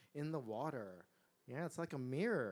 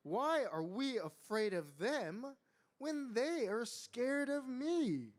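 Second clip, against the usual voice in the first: slow, higher, wide questioning swoops; puzzled wondering; 8 semitones higher, 5 dB louder, slower than usual. A narrator's reading. Young tiger asks himself about fear, wondering. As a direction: ponder it slowly with childlike puzzlement. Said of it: "Teddy wondered".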